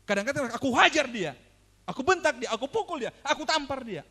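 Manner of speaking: loud, punched short phrases; forceful outburst. Acted. Preacher voices men who use violence, loud forceful list.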